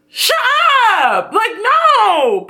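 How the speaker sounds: exasperated outburst. Real, not acted. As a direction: cut them off hard. Reacting to insult, exasperated.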